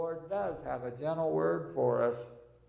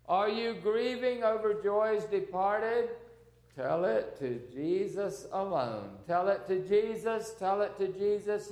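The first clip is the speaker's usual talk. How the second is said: forceful, measured recitation, questions raised and answers falling; hymn recited as preaching; 6 dB louder than usual. Real, not acted. Preacher asking the weary to tell Jesus, fervent hymn recitation.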